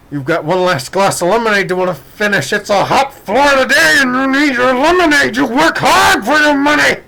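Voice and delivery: high, loud self-hype building up; mirror pep talk. Retold or imitated. Psyching himself up in the bathroom mirror, loud and high. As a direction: pump yourself up like a coach.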